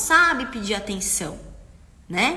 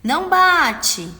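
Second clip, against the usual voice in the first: loud, higher, slow scold; yelling parent; 7 semitones higher, 10 dB louder, much slower than usual. Shown as a demonstration. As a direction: bark the rule out loud. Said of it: "mas você tá gritando". (gritando com a criança)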